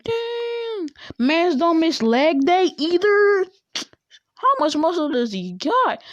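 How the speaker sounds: high, drawn-out admiring exclamation; impressed admiration. Acted. Girl spying on shirtless boy training, impressed.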